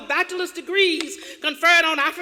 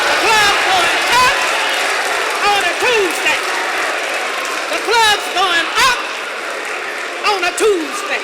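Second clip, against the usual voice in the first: hype shout; 3 semitones higher, 5 dB louder than usual. Retold or imitated. Echoing the party hype.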